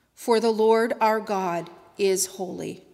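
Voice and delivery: usual speaking voice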